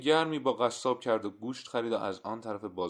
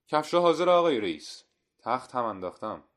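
called out higher and much louder; cheerful shopkeeper calling to a valued customer; hearty announcement; 5 semitones higher, 10 dB louder than usual. A narrator's reading. Cobbler telling his customer the shoes are ready, hearty.

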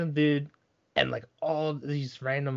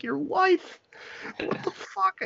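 very high, squeaky startled disbelief, last words breathed; shocked disbelief; slower than usual. Retold or imitated. Caught dancing with a married woman, squeaky shocked reply.

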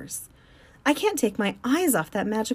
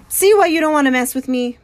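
threatening; 6 semitones higher, 9 dB louder than usual. Acted. Mac threatens with the claw stick.

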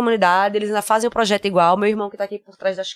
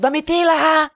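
calling out; 6 semitones higher, slower than usual. Retold or imitated. Father calling her name.

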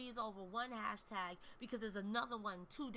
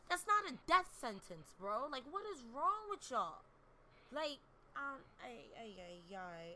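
real fed-up outrage; exasperated disbelief. Real, not acted. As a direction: call out nonsense you can't believe. Angry retort to victim-blaming excuses.

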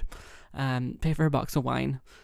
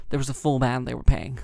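ordinary delivery

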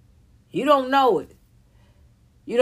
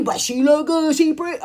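higher, jeering fan voice; mocking imitation; 4 semitones higher, 4 dB louder than usual. Mimicking people who excuse the pretty women, mocking. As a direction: mimic the defenders in a raised voice.

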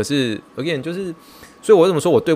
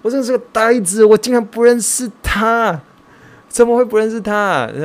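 much higher put-on voice berating himself; mock self-scolding; 10 semitones higher, 4 dB louder than usual. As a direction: squeak out the scolding at your own foolishness. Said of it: "你就一直在骂我说".

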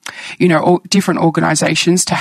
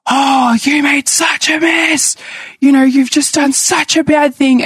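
high, scolding grandmother's shout; 9 semitones higher than usual. Retold or imitated. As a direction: mimic her shrill telling-off at a small child. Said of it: "she'd be like".

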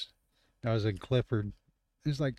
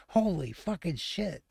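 retold shock; 7 semitones higher than usual. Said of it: "he's like".

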